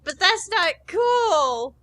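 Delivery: high, slow, put-on kid voice; whiny kid protest. Chris protests to Robotnik in a high, slow whine. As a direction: drag out the complaint like a whiny boy.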